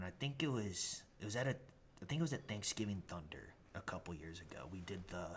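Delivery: ordinary talk, nothing marked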